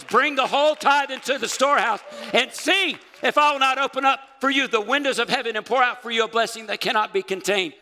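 high, loud scripture proclamation; full-force preaching. Acted. Preacher quoting the Bible on tithing, full-force preaching. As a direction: shout the promise out to the whole church.

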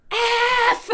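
high, loud scream of one word; loud frustrated scream; screamed curse. Retold or imitated. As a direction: shriek it out in frustration. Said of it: "scream the F word".

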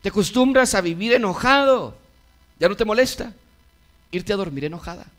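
fiery sermon climax; preaching at full force. Acted. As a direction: plead urgently with the congregation. Urgent loud preaching passage.

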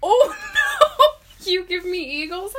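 squealing laugh through the words. Real, not acted. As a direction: laugh in disbelief. Laughing disbelief.

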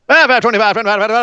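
high, rapid-fire auction chant; hammed-up livestock auction patter; auctioneer. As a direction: rattle off bids like an auctioneer. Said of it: "that was like an auctioneer".

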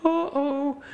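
very high cartoon uh-oh; a drawn-out cartoon gulp of realization; hammed-up high alarm; Scooby moment. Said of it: "Shaggy and Scooby moment".

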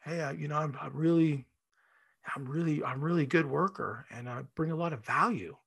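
soft, hesitant plea for a raise; timid asking. Retold or imitated. Employee meekly asking the boss for a raise, soft and hesitant. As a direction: shrink back and barely ask. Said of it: "you can ask for a, a raise from your boss".

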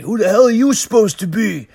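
high, loud mock tough-guy challenge; sneering thug. Thug mocking Batman, in a high loud jeering voice. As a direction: sneer it like a street punk. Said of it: "he's like".

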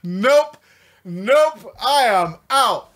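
loud, high laughing "Nope. Nope."; hammed-up shocked exit; mock panicked refusal. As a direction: bail out loudly and high.

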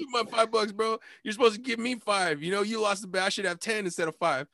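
high-pitched mock insistence; playful demand. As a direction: press him for the money in fun. Retold demand continues.

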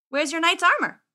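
loud, high question; puzzled boy wizard. Acted. Wizard puzzling over squire's missing armor, puzzled.

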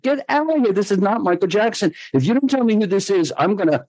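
mock-furious threat to hang up; a caller dismissed as a prank, pitch soaring; incredulous outburst. Angry at supposed crank caller.

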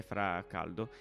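usual speaking voice

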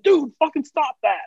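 high-pitched exasperated outburst; out-of-control anger. Acted. Someone pushed too far snapping at a friend, high-pitched mock fury. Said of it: "when it gets out of control".

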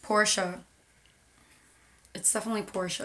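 Porsche said as "POR-shuh"; two-syllable Porsche. Shown as a demonstration. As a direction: sound the final e in Porsche. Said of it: "how to pronounce it".

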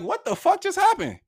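high-pitched, baffled outburst; bewildered disbelief. Retold or imitated. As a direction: question it in utter disbelief. Bewildered at being dragged out.

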